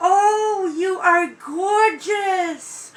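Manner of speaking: overwhelmed, stretching the words; tearful gushing. Real, not acted. Crying over the doll.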